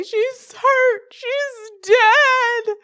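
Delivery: very high, long-drawn wail; hammed-up despair. Owner convinced her missing cat died, exaggerated high wail. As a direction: wail it as mock sobbing.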